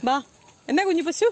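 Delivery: high, coaxing call to a little calf. Real, not acted. Calling to the calf.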